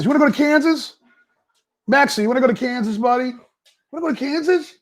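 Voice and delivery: high pet talk; talking to the dog. Real, not acted. Owner asking his dog about Kansas, high pet talk.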